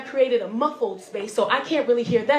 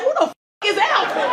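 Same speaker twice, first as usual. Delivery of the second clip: loud, high-pitched indignation; indignant outburst; 9 semitones higher, 5 dB louder than usual. Acted. Crash victim expecting heaven hears of Alvin, indignant outburst. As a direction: demand it in mock outrage.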